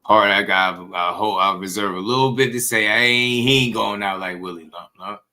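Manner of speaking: usual speaking voice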